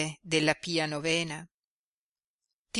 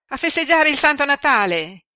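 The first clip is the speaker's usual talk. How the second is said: put-on much higher and louder, delighted girl; excited exclamation; 9 semitones higher, 10 dB louder than usual. A narrator's reading. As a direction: cry it out with Christmas joy. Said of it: "esclamò Jenny".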